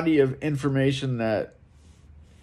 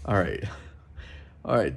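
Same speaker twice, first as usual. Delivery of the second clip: breathy laugh; 4 semitones lower, 6 dB quieter, faster than usual. Real, not acted. Laughing off a dark thought.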